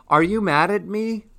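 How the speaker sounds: high worried question; nervous, needy high voice; anxious blurt. A narrator's reading. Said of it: "dropped the flowers and blurted".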